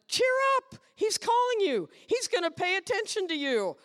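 high, loud, thrilled encouragement; excited cheer up. Retold or imitated. Crowd telling the blind man with loud excitement. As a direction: burst out with joy. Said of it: "Do you love it?".

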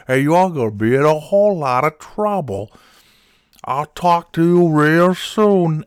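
put-on high, loud angry old man voice; angry old man prank caller. Prank caller as a furious old man demanding his cards. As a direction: rant like a cranky old customer on the phone. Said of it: "the angry old diecast cars guy".

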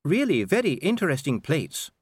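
high-pitched, effortful compliment; dutiful politeness. A narrator's reading. Well-bred lady politely praising plates instead of food, dutiful. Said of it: "she said dutifully".

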